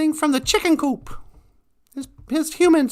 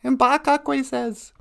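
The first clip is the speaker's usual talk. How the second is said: plain reading voice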